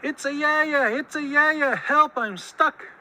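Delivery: high, urgent cry; calling for help. A narrator's reading. Stuck Muskrat calls for Coyote, urgent cry. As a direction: call out from where you're trapped. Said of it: "So he called for Coyote".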